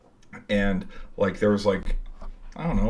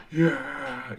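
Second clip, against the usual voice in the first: higher, drawn-out mock cheer; imitated cheer; 5 semitones higher than usual. Group moshing for the big camera, imitated cheer.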